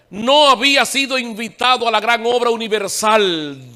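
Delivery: proclama alto, con pausas solemnes; prédica enfática. Real, not acted. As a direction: alarga las palabras con fuerza. Predica a plena voz. (predicación enfática y pausada)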